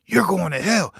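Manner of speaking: high, loud, slow preachy condemnation; imitating a fired-up church member; accusing churchgoer. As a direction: wag a finger and damn him. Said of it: "he said".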